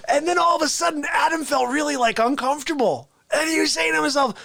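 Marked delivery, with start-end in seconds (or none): none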